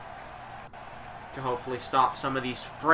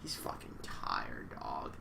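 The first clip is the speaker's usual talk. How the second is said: much lower and much softer, slow; low sleepy mutter; 17 dB quieter, slower than usual. Real, not acted. Streamer explaining his tired friend, low and sleepy. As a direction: mumble it like half asleep.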